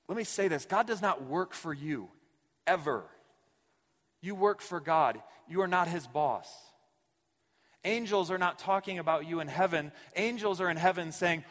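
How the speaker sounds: pastor hammering the point home to the congregation; forceful preaching. Acted. Preacher insists God does not work for you, forceful and loud.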